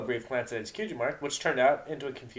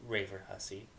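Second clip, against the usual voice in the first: 1 muttered insult; 4 semitones lower, 10 dB quieter than usual. Acted.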